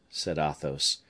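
plain reading voice

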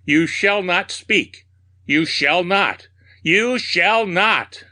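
high, drawn-out mad commands; theatrical raving, slow and emphatic. A narrator's reading. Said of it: "I raved".

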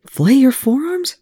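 a nurse startled by his self-inflicted wounds; shocked disbelief. A narrator's reading. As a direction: she can't believe what she heard. Shocked nurse repeats his words.

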